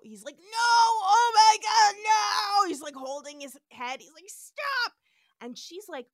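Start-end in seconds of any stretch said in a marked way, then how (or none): 0.4-2.7 loud, high anguished wail; freaking out. Retold or imitated. Retelling Spock hit by spores, freaking out. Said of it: "He is freaking out". 4.3-4.9 high, loud pained yell; freaking out. Retold or imitated. Retelling Spock begging the pain to end, freaking out. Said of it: "He is freaking out".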